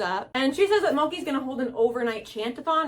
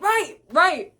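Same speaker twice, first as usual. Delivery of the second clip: hasty, higher, louder right right; flustered agreement; 6 semitones higher, 4 dB louder than usual. Retold or imitated. Boober in disguise agrees nervously, higher and louder. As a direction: cover up fast and nod along. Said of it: "And he's like".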